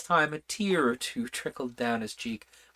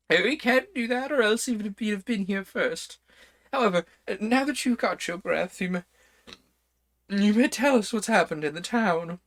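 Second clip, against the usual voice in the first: put-on higher, louder fussy old king; 9 semitones higher, 3 dB louder than usual. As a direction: play the King, excitable and bossy. Reader voices the King.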